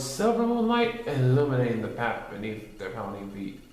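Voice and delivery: ordinary delivery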